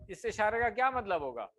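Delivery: normal talking voice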